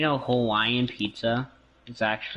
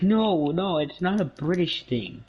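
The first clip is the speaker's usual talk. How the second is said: higher, imitating an annoyed friend; mock exasperated protest; 8 semitones higher, slower than usual. Wisconsin friend objecting to being teased, imitated in mock exasperation. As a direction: protest in a raised, mock-offended voice. Retells friend getting mad.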